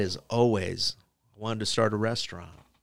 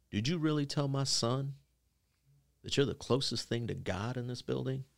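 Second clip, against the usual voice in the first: soft, measured imitation of a stern dad; pause, then challenge him under your breath; quiet confrontation; 2 semitones higher, 9 dB quieter than usual. Dad confronts teacher, retold.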